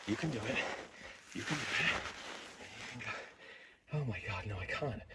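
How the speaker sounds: whispered self-encouragement; scared, breathless murmur; frightened whisper. Real, not acted. Self-encouragement then giving up.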